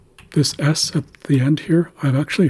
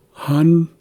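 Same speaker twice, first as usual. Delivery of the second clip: "Hun" high and louder; higher tone; 5 semitones higher, 5 dB louder than usual. Shown as a demonstration. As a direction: start high on "Hun", held as a demo syllable. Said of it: "at a higher tone".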